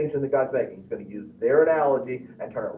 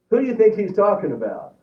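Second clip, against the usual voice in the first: usual speaking voice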